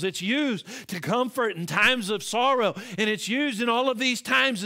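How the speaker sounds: loud, high, ringing sermon delivery; preaching. Acted. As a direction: preach it out to the whole church. Preaching to congregation.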